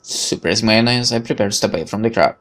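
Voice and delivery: ordinary talk, nothing marked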